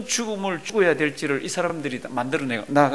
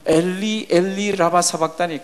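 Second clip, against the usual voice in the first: raised-voice quote of Jesus' cry; half-shouted scripture cry; imitated loud cry; 4 dB louder, slower than usual. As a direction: cry it out as a preacher quoting. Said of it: "큰 소리로 소리 지르면서 막 그럽니다".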